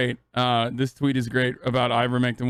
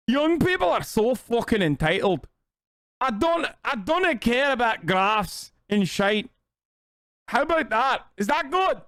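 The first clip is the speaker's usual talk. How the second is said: much higher, hammed-up Scottish grumble; mock Scottish accent; 7 semitones higher than usual. Streamer mocking a tweet author in a rough Scottish accent. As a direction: play a ranting old Scot, badly faked. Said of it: "I'll do Scottish".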